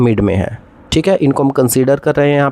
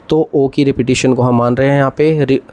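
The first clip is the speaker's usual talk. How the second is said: ordinary talk, nothing marked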